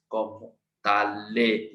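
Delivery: comment allez drawn out, t linked into allez; French liaison. Shown as a demonstration. Teacher merging the t for students, slow. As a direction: run comment allez together as ko-mon-ta-lay, slowly. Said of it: "we have to merge T with this".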